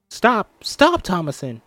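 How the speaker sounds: high, loud begging stop; scared little girl begging; child pleading. Acted. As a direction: plead with your sister to quit. Mercy begs sister.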